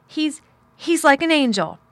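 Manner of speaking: high, loud, impassioned outburst; stumble, then declare it passionately; fiery defence. A narrator's reading. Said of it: "Mary's eyes flashed fire".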